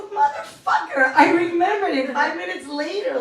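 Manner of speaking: comic indignation at being refused; exasperated outburst. Retold or imitated. Exasperated at password rejection.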